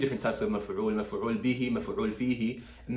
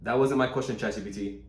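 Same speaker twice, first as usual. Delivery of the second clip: usual speaking voice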